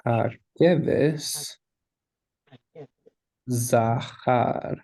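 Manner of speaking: Hakeves, long pause, then Zachar; slow, spaced-out reading of Hakeves ... Zachar; word by word. Shown as a demonstration. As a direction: sound out Hakeves Zachar slowly, each word separate. Teacher sounds out Hebrew phrase.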